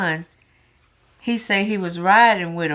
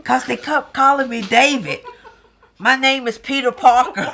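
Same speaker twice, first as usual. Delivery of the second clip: laughing while quoting; 7 semitones higher, 5 dB louder than usual. Retold or imitated. Mother quoting David while laughing. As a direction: quote broken by laughter.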